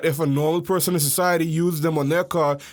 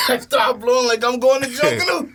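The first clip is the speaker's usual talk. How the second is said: loud howling laugh into high speech; laughing hard; 3 dB louder than usual. Real, not acted. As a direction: laugh so hard you almost shout. Laughing fit over whistle story.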